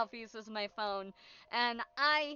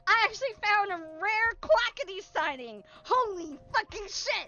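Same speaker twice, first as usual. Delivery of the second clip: higher and louder, eager telling; excited retelling; 5 semitones higher, 6 dB louder than usual. Real, not acted. Streamer excitedly tells of spotting Quackity, higher and louder. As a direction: rush it out thrilled at spotting him.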